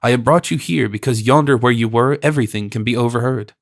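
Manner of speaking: usual speaking voice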